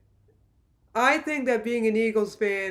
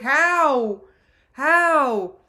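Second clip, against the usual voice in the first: loud, high, stunned repeated cry; disbelieving shout; 4 semitones higher, 7 dB louder than usual. Retold or imitated. Fan replaying the last-minute win, loud disbelief. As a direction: yell it like you can't believe it.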